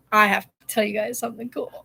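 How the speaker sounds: ordinary delivery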